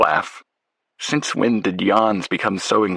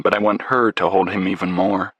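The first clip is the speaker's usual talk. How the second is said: plain reading voice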